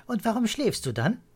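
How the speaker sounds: put-on high, insistent child's question. A narrator's reading. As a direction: press him like a nagging kid. Children will not let go.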